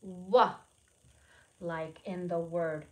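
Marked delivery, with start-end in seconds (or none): 0.0-0.5 W said alone as the letter sound; letter sound demo. Shown as a demonstration. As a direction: show the kids the sound of W. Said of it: "makes the sound of W".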